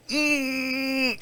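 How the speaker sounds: long, high, soft "mm"; squeezing hug hum. Pretend. Giving listeners a big hug, squeezing hum. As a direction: strain out a drawn-out hug noise.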